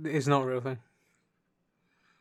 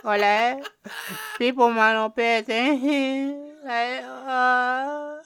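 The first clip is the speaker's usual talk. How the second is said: put-on loud, high, whiny Pakistani accent; high put-on Pakistani accent, drawn-out endings; mock Pakistani accent; 11 semitones higher, 5 dB louder than usual. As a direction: ham up a Pakistani accent, near crying. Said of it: "I'm doing it in Pakistani".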